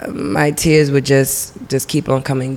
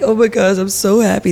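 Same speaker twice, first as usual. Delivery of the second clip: lightly gushing, higher voice; mock happy gushing; 5 semitones higher than usual. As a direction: imitate being happily overcome, a little higher. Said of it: "I could be happy".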